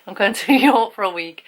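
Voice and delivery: giggling as she repeats the trip news; laughing. Real, not acted. Telling her subscribers about New York, giggling. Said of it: "I'll tell you anyway".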